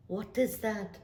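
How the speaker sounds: ordinary talk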